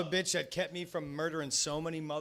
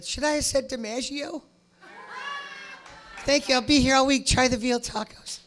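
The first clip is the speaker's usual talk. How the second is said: much higher, louder puppy voice; dog voice; 10 semitones higher, 4 dB louder than usual. Pretend. Dog Waylon playing the comedian, high puppy voice. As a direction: do the dog sulking then taking a bow. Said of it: "and he said".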